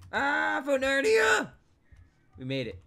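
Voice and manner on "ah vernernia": very high, loud holler; high sustained battle-cry shout; sudden yell. Acted. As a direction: yell it out at the top of the range. Sudden yell during play.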